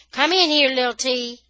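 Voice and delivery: high, loud call. Acted. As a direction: call him in from the house. Father calling from the house.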